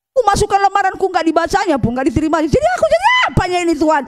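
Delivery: high, rushed cry to God; anguished outcry. Retold or imitated. Preacher reliving her cry to God, high and fast. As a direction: pour out frustration.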